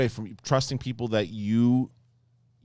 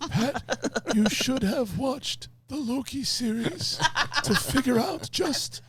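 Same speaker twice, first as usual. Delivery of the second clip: high, slow, frail dying voice; dying old wizard; 14 semitones higher, slower than usual. Acted.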